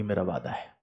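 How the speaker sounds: normal talking voice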